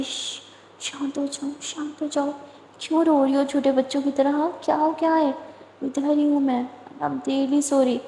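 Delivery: put-on calm her like a crying child; soothing hush. A narrator's reading. Comforting crying girl in hug.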